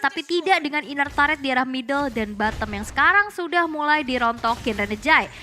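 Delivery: rising hype on the big play; esports caster. Acted. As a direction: call the action, build to the kill. Casts the match play-by-play. (komentator esports yang bersemangat)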